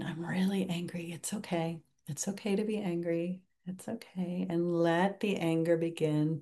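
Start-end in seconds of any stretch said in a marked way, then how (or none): 0.0-4.5 hushed whisper, calming yourself down; soft self-soothing. Shown as a demonstration. Teacher showing students how to soothe their anger, whispered softly. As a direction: whisper gently as if settling your own anger.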